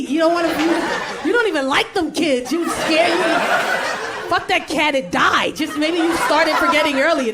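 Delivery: spiteful sibling, acted outrage; mad. Twin attacking sister's list of loved ones, mad. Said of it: "Why are you mad?".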